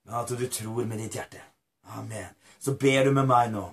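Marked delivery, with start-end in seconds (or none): none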